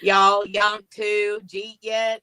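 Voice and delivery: slow drawled Southern "Y'all"; Southern twang. Pretend. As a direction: lay on a slow Southern drawl for "Jeet yet". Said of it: "I've got a southern twang".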